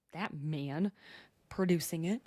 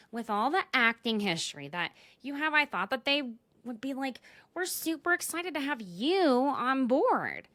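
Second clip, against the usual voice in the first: pitched-up, placating mock castmate; voicing Taylor; 5 semitones higher than usual. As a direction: act out an eager explanation, higher. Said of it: "then Taylor says".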